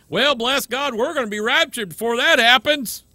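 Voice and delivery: hammed-up high churchy voice; mock pious believer. Preacher mocking believers sure of the rapture. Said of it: "mocking a complacent believer".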